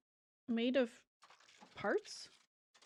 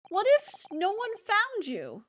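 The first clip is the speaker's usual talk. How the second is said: voiced character; 8 semitones higher, 5 dB louder, slower than usual. Voicing character, slow far.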